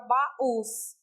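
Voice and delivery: "baús" split into ba-ÚS; syllables separated. Shown as a demonstration. Teacher contrasting the accented word, syllables separated. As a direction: say "baús" in two syllables, stress on the U. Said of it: "Esse us foi acentuado".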